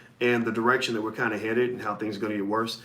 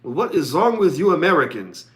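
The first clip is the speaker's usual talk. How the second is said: lightly imitated German accent, a bit louder; playful German accent, mildly raised; 4 semitones higher, 4 dB louder than usual. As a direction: quote a German colleague in a mock German accent. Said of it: "he said in the German accent".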